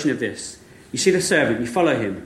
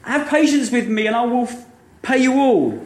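higher, lightly pleading plea; mild begging; 7 semitones higher than usual. Acted. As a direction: beg for mercy, understated. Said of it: "And the servant begs".

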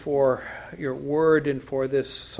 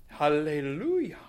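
Southern hallelujah. Shown as a demonstration. Preacher tries a regal Southern accent hallelujah. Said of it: "that's a very regal accent, Southern accent".

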